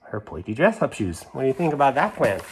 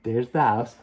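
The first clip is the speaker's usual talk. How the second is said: usual speaking voice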